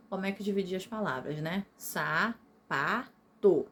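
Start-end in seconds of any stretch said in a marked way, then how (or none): 1.8-3.7 SAPATO broken into three slow beats, sa, pa, to; split into syllables. Shown as a demonstration. Teacher recalling grammar syllables from primary school, SAPATO slowly split. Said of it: "Que a gente aprendeu assim".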